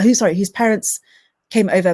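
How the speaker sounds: ordinary delivery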